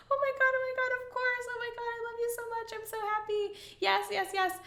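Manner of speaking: high, breathless excited squeal; overjoyed yes. Retold or imitated. Accepting the marriage proposal on the porch, ecstatic high squeal. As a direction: gush it out in disbelieving joy. Reliving her answer to the proposal.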